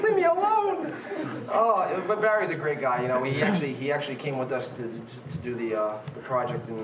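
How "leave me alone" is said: high, louder mock plea through laughter; laughing protest. Telling Barry to leave him alone, high and laughing. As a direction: beg to be left alone while cracking up.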